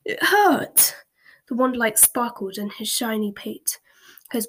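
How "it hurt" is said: aggrieved whine. A narrator's reading. Horace complaining after being poked.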